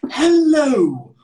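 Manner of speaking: high, drawn-out, over-enunciated greeting; mock fake RP. Mimicking stiff learners, mock fake RP. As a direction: stretch every word in a loud, high put-on voice.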